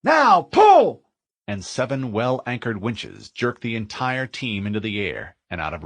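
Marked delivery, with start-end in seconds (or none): 0.0-1.0 high, loud commanding scream. Acted. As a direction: bellow the order to pull. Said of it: "McKay screamed".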